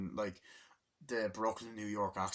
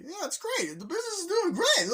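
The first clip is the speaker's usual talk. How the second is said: very high, louder falsetto squeak; squeaky mock voice; 10 dB louder than usual. Retelling family dinner banter, squeaky mock falsetto. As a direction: overplay the proud relative. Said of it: "Yeah, this is great".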